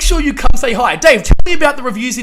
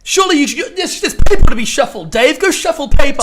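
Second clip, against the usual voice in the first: mocking; 4 semitones higher, 6 dB louder than usual. Mocking naysayers telling Dave.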